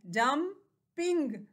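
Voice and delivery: Jumping very slow, pause between जं and ping; two separate beats in jumping; split into syllables. Shown as a demonstration. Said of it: "इसमें टू syllables हैं". (Jumping को दो हिस्सों में रुककर)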